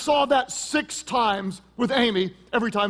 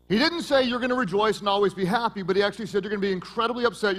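normal talking voice

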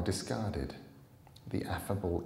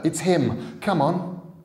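put-on much higher, louder urgent boy; boy warning his friend; 10 semitones higher, 10 dB louder, slower than usual. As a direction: alarm her with a panicked call.

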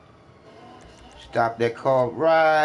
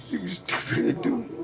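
slow, higher, dragged-out mocking voice; mocking drawl; 7 semitones higher, 5 dB quieter, slower than usual. Taunting an opponent in an online game, slow mocking higher voice. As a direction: stretch it out like you're talking to a fool.

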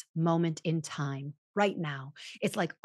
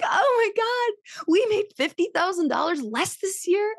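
mock alarm; 13 semitones higher, 4 dB louder than usual. Wife telling husband the income dropped, hammed-up alarm. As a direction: play up the shock at the lost money.

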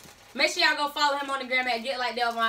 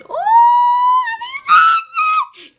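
hyped wail; 10 dB louder, much slower than usual. Acted. Hyped sung-out intro shout.

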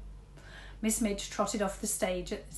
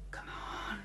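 whisper; 9 dB quieter than usual. A narrator's reading. Girl urges rat silently.

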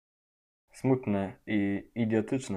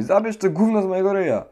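disgusted; 7 semitones higher, 4 dB louder, faster than usual. Acted. Dog is licking him.

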